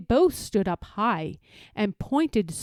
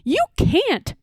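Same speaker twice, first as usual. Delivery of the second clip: a sharp, much higher retort; snapped; 10 semitones higher than usual. Acted. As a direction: snap back in disbelief. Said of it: "snapped the rabbit".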